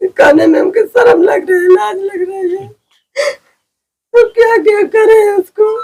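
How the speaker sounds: high, breaking voice near tears; tearful shame. Real, not acted. Woman tells reporter of police abuse, crying high. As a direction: choke out the shameful words, crying.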